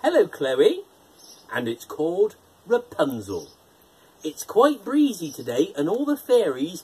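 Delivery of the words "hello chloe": ordinary delivery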